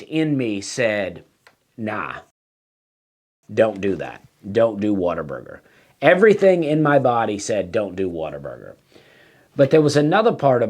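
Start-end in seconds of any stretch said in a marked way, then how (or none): 1.8-5.6 low, soft gut-feeling warning; inner voice warning. Retold or imitated. His instinct warning him off Whataburger, low and soft. 7.7-8.7 much lower and softer, almost to himself; inner voice warning. Retold or imitated. His body telling him to skip Whataburger, low and soft.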